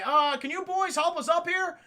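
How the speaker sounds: high-pitched calling out; imitated fake-helpless shout upstairs; Han Solo. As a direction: call for help in a put-on innocent voice. Han luring troopers upstairs.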